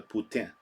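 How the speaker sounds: Poutine as the French say it; French pronunciation. Shown as a demonstration. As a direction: give Poutine a nasal French ending, no "teen". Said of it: "That's how the French say it".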